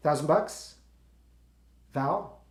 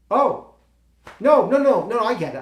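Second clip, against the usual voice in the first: high, louder rushed no-no-no; flustered backpedal; 7 semitones higher, 10 dB louder than usual. Real, not acted. Actor turned down by his friend, backpedalling high and loud. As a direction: cover the sting of rejection fast.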